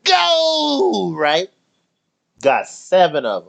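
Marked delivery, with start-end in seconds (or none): 0.0-1.1 high hyped "let's go"; mock hype yell; hyped cheer. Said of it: "I was like".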